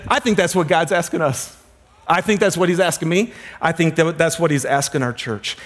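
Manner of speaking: ordinary talk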